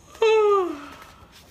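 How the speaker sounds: loud high yawning sigh; big yawn. Acted. Mister Sun giving a big sleepy yawn. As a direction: yawn wide and long. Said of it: "a big yawn".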